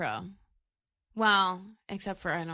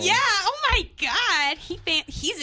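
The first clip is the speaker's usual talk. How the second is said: mock gushing; 8 semitones higher, 5 dB louder than usual. Co-host teasing a man's cologne flex, mock gushing. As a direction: gush over him in jest.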